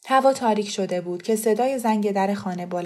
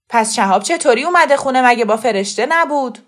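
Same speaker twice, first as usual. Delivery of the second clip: raised-voice interrogation; loud challenging questions; 4 semitones higher, 9 dB louder than usual. Acted. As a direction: demand an explanation loudly. Khosrow challenges the mother's answer.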